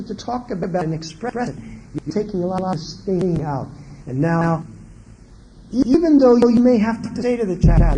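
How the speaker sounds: ordinary delivery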